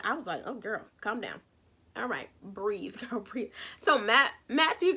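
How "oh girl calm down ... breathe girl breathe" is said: low, very soft, talking her down; calming a friend. Retold or imitated. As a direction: gently tell her to settle, half amused. Retold calming of a worked-up friend.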